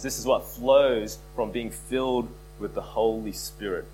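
ordinary delivery

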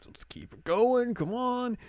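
high, drawn-out mock urging; Nodoka zagrzewająca zespół. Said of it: "they get a sign from Nodoka".

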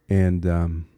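ordinary delivery

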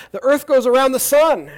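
acted excited announcement. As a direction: announce a discovery with playful zeal. Acts Galileo announcing his discovery.

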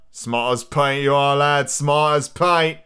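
drawn-out Australian pirate voice; loosely Australian old sea dog; Australian accent. Acted. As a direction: try a hearty, slow Australian twang. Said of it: "it's kind of Australian almost".

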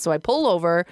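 normal talking voice

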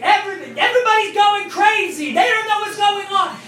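very high, loud hysterical wife; mock screaming. Wife screaming in the background of a phone call, mock-hysterical screech. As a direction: shriek it in comic panic. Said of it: "she starts screaming".

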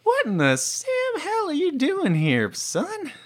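high, put-on old fatherly voice; fatherly drawl. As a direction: scold him like a folksy father. NPC greets like a father.

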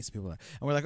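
ordinary delivery

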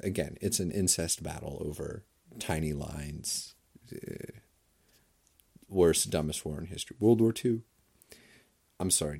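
ordinary delivery

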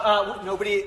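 ordinary talk, nothing marked

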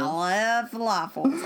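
hammed-up Texan accent; mock Southern twang. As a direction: stretch falafels out like a Texan. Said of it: "I've been watching too much, like, uh, South".

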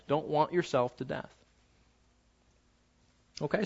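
ordinary talk, nothing marked

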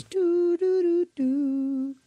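high sung doo notes imitating a chime; intercom chime. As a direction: hum the game's intercom jingle, high. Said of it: "right before it goes".

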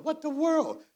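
high-pitched incredulous exclamation; high, baffled outburst; mock shock. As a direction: react in disbelief, voice jumping up.

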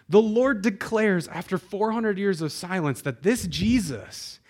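usual speaking voice

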